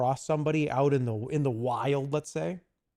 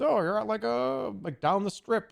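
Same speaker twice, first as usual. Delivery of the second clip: imitating a fan; 8 semitones higher than usual. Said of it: "whether it's".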